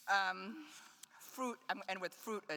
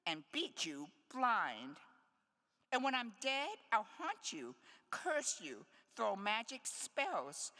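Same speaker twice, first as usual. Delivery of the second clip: higher, mock-fierce grandmother threat; threatening grandmother; 7 semitones higher than usual. Grandmother in poem warns granddaughter's suitor, mock threatening. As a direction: scold like a granny promising curses.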